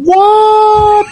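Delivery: high squeaky puzzled shout of "What?"; squeaky confused "What?". Pretend. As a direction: yelp "What?" like you can't hear anyone. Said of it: "I'm just gonna say over and over again".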